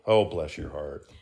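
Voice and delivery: slow, drawn-out patronizing pity; slow syrupy Southern put-down; condescending pity. Retold or imitated. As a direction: say it like patting someone on the head. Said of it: "that's what it sounds like".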